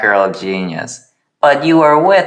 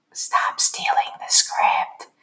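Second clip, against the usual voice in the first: whisper; 8 dB quieter, slower than usual. Acted. Character quote whispered aside.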